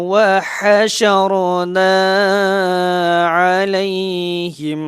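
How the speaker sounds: slow, drawn-out chanted Quran recitation, held long on each vowel; teacher chants the verse slowly for the students, in tajweed recitation style. Shown as a demonstration.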